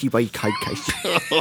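stretched, laughing, very slow podcast; mangled slow sign-off. Pretend. Friend botching the outro, laughing slowly. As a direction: drag the word out while cracking up. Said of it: "Oh my God, that was abysmal".

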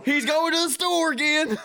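high, loud mock neighbor; gossiping neighbor voice. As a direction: squeal it like a nosy neighbor. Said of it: "everybody's like".

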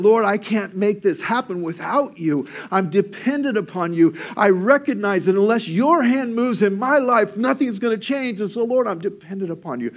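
preached prayer, rising high; impassioned prayer. Acted. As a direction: plead to the Lord, building.

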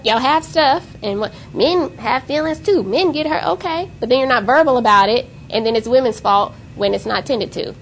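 ordinary talk

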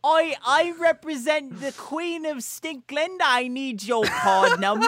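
high, slow, hammed-up British proclamation; pompous fake British accent, pitched high; mock British accent. Poses as the Queen's British representative.

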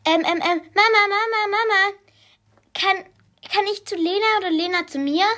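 hoch, hastig, überschlagen und schnell; herausplatzend. A narrator's reading. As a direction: sprudelt aufgeregt los wie ein Kind. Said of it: "es prustete aus ihr raus". (Mara stürmt zu Mama und sprudelt ihre Frage hoch und schnell heraus)

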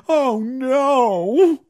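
high hammed-up oh no; overacting. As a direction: ham up the dismay. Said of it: "overacting one".

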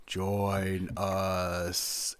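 long, drawn-out ghostly call; imitating movie voice. Recalling the voice outside in the film, drawn-out haunting imitation. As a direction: stretch both words out like a haunting voice. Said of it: "I just remember the voice going".

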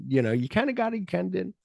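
teasing teacher. Retold or imitated. Retelling the teacher's verdict on a poem, high. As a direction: tease it up high and wobbly. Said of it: "He's like".

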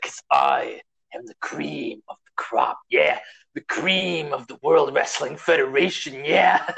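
slow, raspy-sounding shouted promo; Macho Man impression. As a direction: growl it out like a hyped wrestler. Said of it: "it's like".

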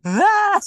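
high, loud laughing yelp; laughing shriek. Real, not acted. Cracking up with her co-host, a loud squeal.

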